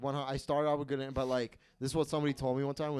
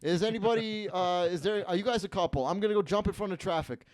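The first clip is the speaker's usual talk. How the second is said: fast, higher, louder cheery emcee voice; hyped host, dying inside; 6 semitones higher, 6 dB louder, faster than usual. Retold or imitated. Emcee describing his hosting nights, in a forced cheery voice. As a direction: chirp the crowd lines while despairing. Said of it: "I'll be like".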